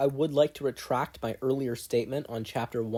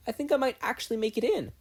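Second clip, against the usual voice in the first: put-on excited boy; 11 semitones higher, faster than usual. Boy excited after exam.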